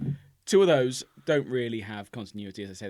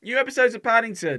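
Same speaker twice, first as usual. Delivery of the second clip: calling up the stairs, raised voice; from another room; 9 semitones higher, 6 dB louder than usual. Retold or imitated. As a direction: shout it up to the next floor. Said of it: "I shouted up the stairs".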